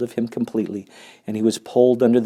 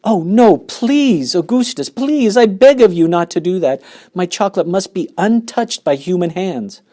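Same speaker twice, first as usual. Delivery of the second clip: high, loud, pleading alarm; the owner pleading over his precious chocolate; crying out; 9 semitones higher, 6 dB louder than usual. Acted. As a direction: beg him to stop, voice raised high. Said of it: "he cried out".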